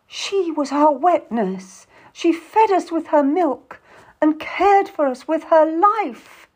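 high, drawn-out melodrama; histrionic. A narrator's reading. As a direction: overplay it, loud and slow. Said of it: "Lucy says histrionically".

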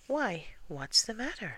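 high-pitched, lively query; concerned question. A narrator's reading. Pooh asking gloomy Eeyore, puzzled and concerned.

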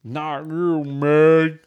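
loud, high, garbled mock cry; Mel. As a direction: bellow it so the words barely come through. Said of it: "what is he saying?".

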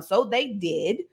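ordinary talk

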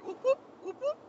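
high, loud whooping siren noise; siren imitation. Runner imitates the cop car's siren, whooping high. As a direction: mimic a police siren with your voice.

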